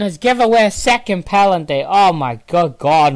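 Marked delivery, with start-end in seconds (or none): 1.9-3.1 slow, loud exasperation; exasperated. Real, not acted. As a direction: groan it out in disbelief. Team gives away second penalty.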